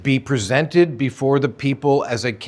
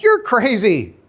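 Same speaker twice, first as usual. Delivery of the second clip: mocking denial; 9 semitones higher, slower than usual. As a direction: brush him off like he's lost it. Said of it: "And they're like".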